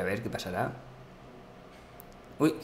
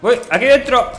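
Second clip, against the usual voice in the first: shout; 8 semitones higher, 7 dB louder than usual. Real, not acted. Alarm, enemies inside.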